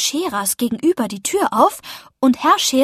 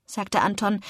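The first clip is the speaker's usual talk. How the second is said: plain reading voice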